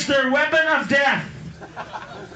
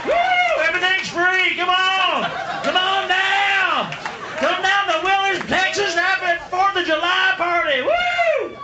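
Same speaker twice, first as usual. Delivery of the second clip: [name]; 8 semitones higher, slower than usual. Retold or imitated. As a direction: whoop it up like a giddy host. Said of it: "He's like".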